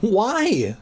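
lightly acted trembling question. A narrator's reading. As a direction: ask shakily, voice lifted. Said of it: "asked me in a trembling voice".

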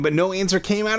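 plain reading voice

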